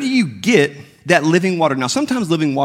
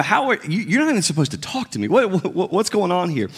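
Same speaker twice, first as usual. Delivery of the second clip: higher, bewildered voice of the woman; incredulous woman; 5 semitones higher than usual. Retold or imitated. Woman at the well questions Jesus, higher and incredulous. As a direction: push back in disbelief, pitched up.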